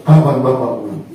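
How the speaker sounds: put-on low, longing child's question; a little boy asking for his father, low and wistful; plaintive child. Boy on the beach asking when father returns, low and longing.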